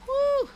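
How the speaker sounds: high whooping cheer of relief; joyful whoop. Real, not acted. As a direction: let out a real whoop. Whoops after surviving a battle.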